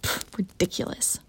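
scornful whispered aside; scoffing whisper. Acted. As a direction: scoff it under your breath.

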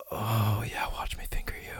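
fast breathy whisper; quick whisper. Acted. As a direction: rush it out in a hushed whisper. Intimate whisper to partner.

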